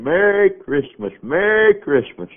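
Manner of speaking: high, slow, ringing shout of Merry Christmas; a father hollering greetings from the wagon; joyful yelling. Retold or imitated. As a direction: call it out across the yard, stretching each word. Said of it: "he was just yelling".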